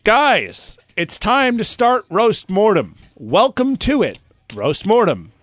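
high, slow announcer welcome; show host intro. Acted.

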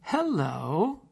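drawn-out hello lifting at the end; singsong hello through the speaker; bank teller's hello. Retold or imitated. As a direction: imitate a teller's uncertain rising greeting. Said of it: "this is what she says".

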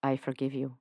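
a hint of Lucifer, tender; Lucifer forgiving.

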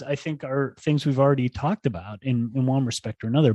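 ordinary talk

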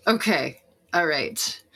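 normal talking voice

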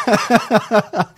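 a loud laugh breaking out. Real, not acted. Hearing the beard phobia joke, a loud laugh.

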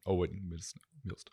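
quiet echo of "Millstone"; echo effect. Shown as a demonstration. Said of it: "The echo thing".

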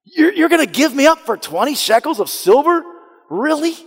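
high, incredulous plea to his brothers; a betrayed brother's high, hurt plea; pleading Joseph. Acted. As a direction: beg them in disbelief.

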